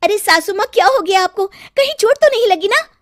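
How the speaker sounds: high, anxious concern; worried daughter-in-law. Acted. Gauri to mother-in-law, high and anxious. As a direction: rush to help her fallen mother-in-law. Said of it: "सास के हाथ लगा के कहता रही".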